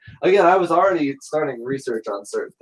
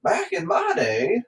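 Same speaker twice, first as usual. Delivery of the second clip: put-on old-timer voice; slower than usual. Old-timer phrase mocked.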